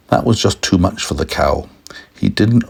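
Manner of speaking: ordinary delivery, nothing marked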